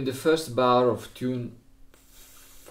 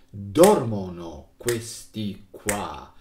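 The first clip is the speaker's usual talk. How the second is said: Dormono stressed on DOR, slow and louder; stress on the first syllable; 6 dB louder, slower than usual. Shown as a demonstration. Teacher gives Italian example, accent on the first. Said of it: "I can make a sample in Italian".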